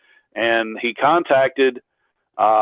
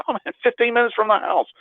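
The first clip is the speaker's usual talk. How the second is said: higher-pitched, mildly excited reenactment; retold pleased surprise; 11 semitones higher than usual. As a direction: replay a delighted reaction, pitched up. Retells pleased reaction to nearby location.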